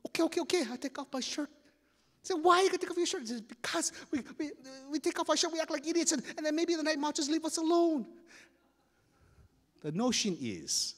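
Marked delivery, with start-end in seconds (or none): 0.1-1.5 high-pitched panicky agreement; acted nervous kid; flustered. Frightened boy gives in. 2.3-3.2 high, loud bewildered cry; acted frightened kid; panicked question. Frightened boy questions his friend. 3.4-8.0 high, wailing explanation; whimpering kid, pitched way up; crying. Acted. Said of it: "Keoki's crying".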